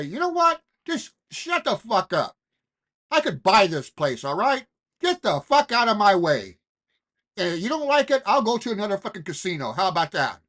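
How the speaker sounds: loud, high, furious telling-off; a brash high roller yelling at the casino owner; shouted with conviction. Shown as a demonstration. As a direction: shout him down like you own the place. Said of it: "You gotta say it so it hits hard".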